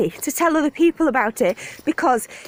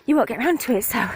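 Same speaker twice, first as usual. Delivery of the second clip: normal talking voice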